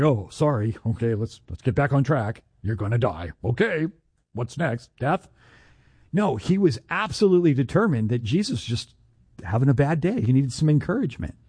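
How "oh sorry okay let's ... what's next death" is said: low, casual mock apology; offhand mock compliance. Peter accepting the rebuke, offhand. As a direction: imitate someone meekly moving on.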